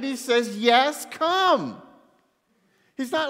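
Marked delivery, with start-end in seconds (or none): 0.6-1.8 loud, high, thrilled invitation; excited. Retold or imitated. Jesus thrilled at Peter's wish to walk on water, excited. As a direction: call out an exuberant welcome. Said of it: "Jesus gets excited".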